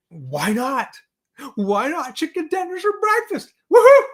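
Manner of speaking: rising to a loud high whoop; excited reply. Retold or imitated. Host retelling his answer to his wife, building to a whoop. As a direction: build to a gleeful cheer. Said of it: "And I said".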